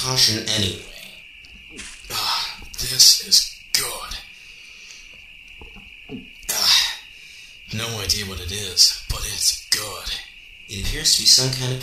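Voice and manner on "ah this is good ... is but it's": slow whispered sigh of pleasure; whispered relish. Acted. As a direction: breathe it out while savoring the food. Hungry cyborg tastes the food.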